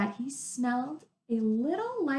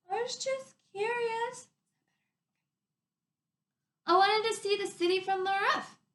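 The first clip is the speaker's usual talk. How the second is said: most innocent voice; 11 semitones higher than usual. Acted. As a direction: play the innocent to the guard. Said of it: "in her most innocent voice".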